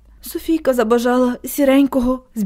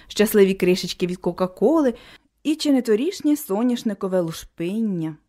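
ordinary delivery, nothing marked

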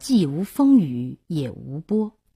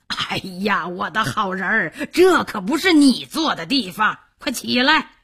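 fussing general; 3 semitones higher than usual. Acted. General finding his bride sitting on the floor, coaxing and urging. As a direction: coax her, then urge her up.

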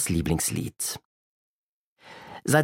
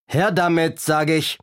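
raised, bullying storybook villain; gruff robber's demand; 6 semitones higher, 3 dB louder than usual. A narrator's reading. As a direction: demand it roughly, pitched up. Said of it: "eine barsche Stimme rief".

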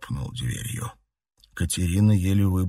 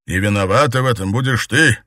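loud, higher cold tyrant; dark lord; 4 semitones higher, 7 dB louder than usual. A narrator's reading. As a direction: blame her coldly. Emperor threatens.

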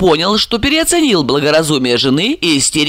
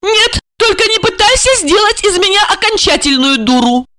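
high-pitched hysterical retort; indignant refusal. A narrator's reading. Wife refusing husband's excuses, indignant refusal. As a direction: cut him off in high indignation.

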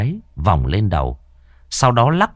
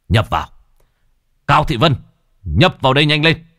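commanding call; 7 semitones higher, 3 dB louder than usual. Acted. Master commands spirit to possess.

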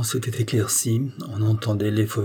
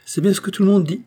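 high, loud blurt; loose-tongued exclamation; 10 semitones higher, 5 dB louder, faster than usual. A narrator's reading. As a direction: cry it out, tongue loosened by wine. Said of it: "s'écria Marianne".

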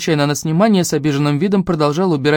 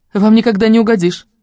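higher, louder complaint; exasperated; 7 semitones higher, 6 dB louder than usual. A narrator's reading. Daughter giving up on pleasing family, exasperated. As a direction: fling it out, fed up.

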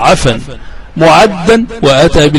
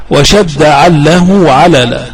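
ordinary talk, nothing marked

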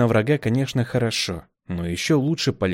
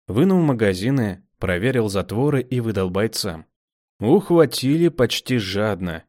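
usual speaking voice

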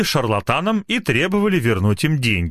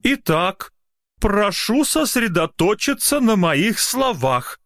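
insistent; 12 semitones higher, slower than usual. Acted. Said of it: "с напором произнёс Палкин".

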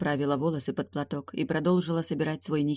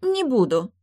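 sullenly; 9 semitones higher, 5 dB louder, slower than usual. A narrator's reading. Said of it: "жмуро ответила Непрядва".

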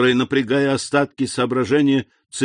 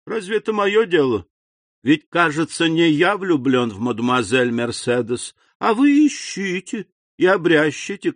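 higher-pitched, dismissive schemer; dismissive Danglars; 7 semitones higher, 3 dB louder than usual. A narrator's reading. Danglars brushing off lovesick Fernand, dismissive. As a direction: shrug off a rival's lovesick troubles, pitch raised.

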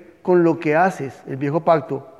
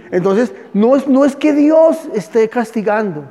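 louder, a fervent preaching push; preaching emphasis; 6 semitones higher, 7 dB louder than usual. Real, not acted. Pastor insisting to the congregation that God isn't punishing them. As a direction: drive the point home with force.

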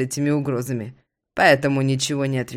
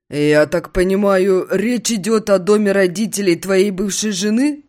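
friend voice; 5 semitones higher, 5 dB louder than usual. Acted. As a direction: ask knowingly.

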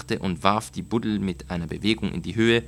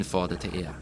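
plain reading voice

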